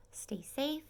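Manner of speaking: ordinary talk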